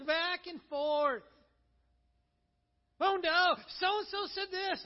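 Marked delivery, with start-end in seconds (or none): 3.0-4.8 a hammed-up worried gasp, pitched up; mock alarm over gossip; mock panic. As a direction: play the fretful gossip.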